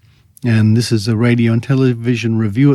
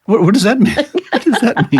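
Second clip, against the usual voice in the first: laughing incredulous question; 9 semitones higher, faster than usual. Real, not acted. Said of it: "repeated with laugh".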